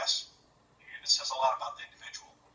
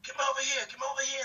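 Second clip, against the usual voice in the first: put-on heavy Australian accent; 11 semitones higher, faster than usual. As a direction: shout it like an Aussie calling people over. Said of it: "in this accent".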